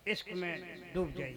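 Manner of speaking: low, soft and tender; tender invitation. Real, not acted. Host inviting the crowd into love, low and soft. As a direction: sink the voice into devotion.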